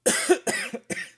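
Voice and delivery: mock hacking cough, high and breathy; Hillary cough. Joking to his viewers about a politician, with a mock hacking cough. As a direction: fake a rough coughing fit as a joke. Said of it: "It's my Hillary impression".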